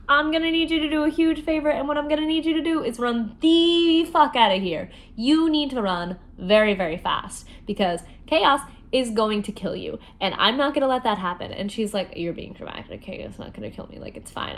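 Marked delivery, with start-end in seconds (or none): 0.1-11.5 much higher and louder, fast; frantic hammed-up warning; urgent mock command. Jax urging Evangeline to flee. 12.2-14.5 much softer, whispered, brushing it off; hushed eye-roll tone; dismissive whisper. Retold or imitated. Evangeline brushing him off.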